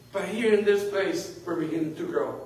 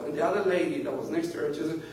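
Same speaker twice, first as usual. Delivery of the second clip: ordinary talk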